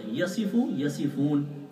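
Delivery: یصفون very slow with a light sad, no doubling; careful یصفون with a plain sad; correct pronunciation. Shown as a demonstration. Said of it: "وصف یصف سے ہے". (یصفون صحیح، صاد بغیر تشدید)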